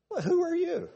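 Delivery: squeaky high sneer; a mocking falsetto jab at the self-admirer.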